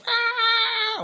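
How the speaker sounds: very high thin screech; imitated hawk scream. Mourning hawk over dead babies, high screech. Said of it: "screaming into the sky".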